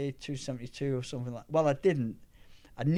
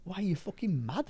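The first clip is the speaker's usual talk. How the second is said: mock disbelief; 7 semitones higher than usual. Retells people's disbelieving reaction.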